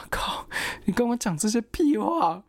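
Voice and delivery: slow, high annoyed scoff; fed up with the nonsense, slow and high. Retold or imitated. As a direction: drag out the disgust, pitched high. Annoyed at useless answer.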